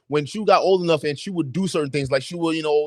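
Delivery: usual speaking voice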